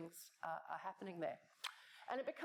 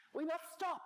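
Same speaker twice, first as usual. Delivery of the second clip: put-on higher, louder Brazilian accent insisting; 9 semitones higher, 4 dB louder than usual. Exec insisting the workshop stop, Brazilian accent. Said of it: "Brazilian accent".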